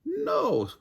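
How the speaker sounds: high teasing no; snickering no. A narrator's reading. Nightingale refusing Jackal, high snickering taunt. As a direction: tease him with a high no. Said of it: "snickered Nightingale".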